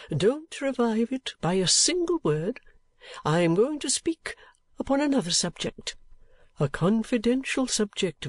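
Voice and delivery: calm yourself down phrase by phrase; old gentleman cooling down. Acted. Old gentleman to Nickleby after his outburst, cooling down.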